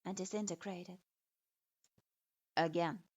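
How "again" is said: low, clipped command from a strict teacher; sharp demand. A narrator's reading. Strict sensei ordering his student to repeat. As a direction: bark it like a stern sensei. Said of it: "Hyuga-sensei demanded sharply".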